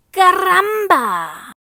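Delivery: loud, high, slow crowing "Caramba"; rooster exclamation. Acted. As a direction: crow it out like a proud rooster.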